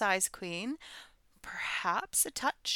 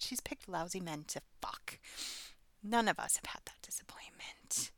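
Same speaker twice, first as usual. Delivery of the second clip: whispered, much softer dig; catty whisper; 3 semitones lower, 8 dB quieter than usual. Real, not acted.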